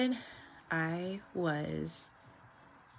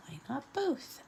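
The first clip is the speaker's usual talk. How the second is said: softer, rising mimic of the little girl; quoting the viral kid again; 7 semitones higher, 5 dB quieter than usual. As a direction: toss the quote off quietly. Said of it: "You know that little girl who was, like, viral".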